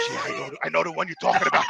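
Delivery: low, soft, quick aside; hushed, hurried imitation of a helper; retold confiding tone. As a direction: imitate a man confiding discreetly. Voicing the nice little guy.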